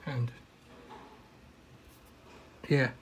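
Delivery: ordinary talk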